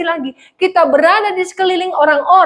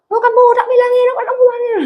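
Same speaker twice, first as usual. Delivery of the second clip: angry attendant; 9 semitones higher than usual. Retold or imitated. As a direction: snap at the driver, high and indignant. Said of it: "dia marah-marah".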